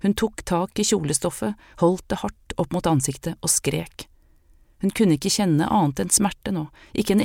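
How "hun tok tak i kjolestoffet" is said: plain reading voice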